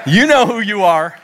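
loud, high call to the crowd; pointed call-out. Real, not acted. Repeats it pointedly to congregation.

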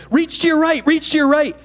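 high, loud calls from below; students calling up. Retold or imitated. Classmates calling up to a stuck climber, loud high calls. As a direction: shout instructions up the climbing wall. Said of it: "the students down here go".

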